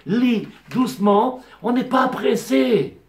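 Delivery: slow, unhurried reading pace; slow and careful. Shown as a demonstration. Rabbi showing how to read Tehilim: slow and careful. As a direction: stretch each word, no rush.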